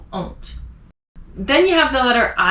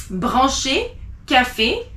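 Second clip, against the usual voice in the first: ordinary delivery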